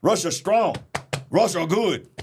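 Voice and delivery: badly faked, high Russian accent. Preacher showing off his Russian accent, badly faked. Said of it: "That's my Russian accent".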